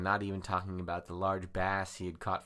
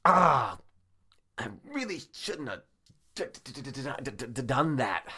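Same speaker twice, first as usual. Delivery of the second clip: loud cry, then high pained stammer; hammed-up hurt, high and stuttering; acted pain; 6 semitones higher, 5 dB louder than usual. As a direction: wince through the words, stuttering on D. Hurt shoulder after slamming the door.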